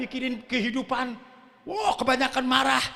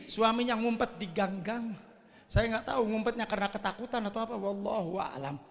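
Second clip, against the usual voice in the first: ordinary delivery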